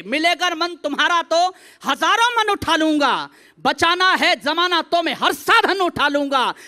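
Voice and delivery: loud declamation, falling away on the last line; fiery stage poet; rousing poetry recitation. Acted. Recites verse building to climax.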